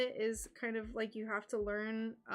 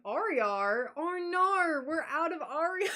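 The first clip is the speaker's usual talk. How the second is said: loud, high mock Aussie server; hammed-up Australian dismay; imitated Australian accent; 6 semitones higher, 6 dB louder than usual. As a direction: exaggerate the Australian "oh no". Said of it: "impersonating Australian accents".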